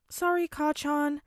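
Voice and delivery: muffled into a cushion. A narrator's reading. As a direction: speak face down into a pillow. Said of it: "voice comes out muffled against the cushions".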